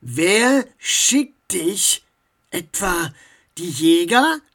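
slow, pointed questioning; deliberate, suspicious interrogation with some force; contained anger. A narrator's reading. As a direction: hold back the fury while demanding an answer. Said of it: "versuchte ruhig zu bleiben".

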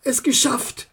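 softly talking himself up, near tears; quiet pep talk. Acted. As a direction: encourage yourself under your breath. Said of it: "sprach er sich Mut zu".

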